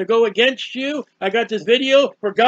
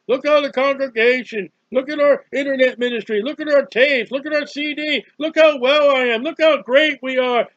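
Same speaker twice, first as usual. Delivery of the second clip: mocking the boastful church; 5 semitones higher, 3 dB louder than usual. Preacher mocking the lukewarm church, high smug brag. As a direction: puff up like a proud congregation bragging. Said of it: "the church is saying".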